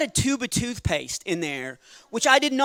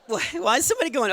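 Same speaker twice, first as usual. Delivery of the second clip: ordinary talk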